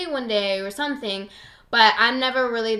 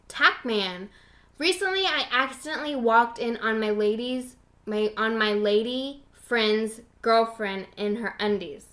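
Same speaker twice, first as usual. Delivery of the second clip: ordinary talk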